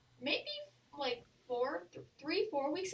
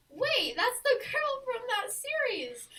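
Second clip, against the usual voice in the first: shocked; 8 semitones higher, 4 dB louder than usual. Retold or imitated. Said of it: "So I was shocked".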